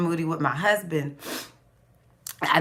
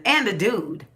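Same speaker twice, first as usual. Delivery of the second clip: normal talking voice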